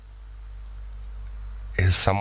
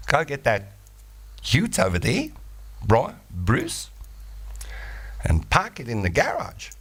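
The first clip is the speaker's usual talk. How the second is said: lightly voiced Australian, raising the end of each phrase; a hint of Aussie with upward inflection; Australian accent; 4 semitones higher, 3 dB quieter than usual. Shown as a demonstration. Caller showing her part Aussie accent, mild rising ends. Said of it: "inflect on the end ... then you got Australian".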